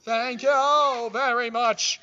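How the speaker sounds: high, loud, stretched thank you; delighted thanks. Real, not acted. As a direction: gush over a surprise gift. Gifted with food live.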